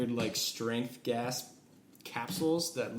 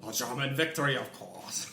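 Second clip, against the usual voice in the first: mock German accent. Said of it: "I don't like Danny's German accent as Ludendorff".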